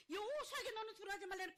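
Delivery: high, loud preacher's cry; fervent proclamation. Acted. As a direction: thunder it out to the congregation. Preacher proclaims God's word at climax. (높고 크게 외치는 설교)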